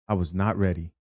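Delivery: usual speaking voice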